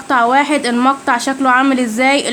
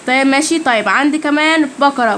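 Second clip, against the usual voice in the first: usual speaking voice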